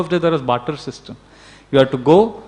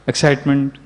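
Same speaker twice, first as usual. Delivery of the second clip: normal talking voice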